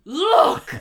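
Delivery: loud, high theatrical cry; imitated villain shout. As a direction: call it out like an over-the-top villainess. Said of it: "she's shouting, she's pointing".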